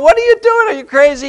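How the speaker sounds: high, loud panicked cry at the driver; a wife yelling at her speeding husband in alarm. Retold or imitated. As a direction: shriek at him to stop.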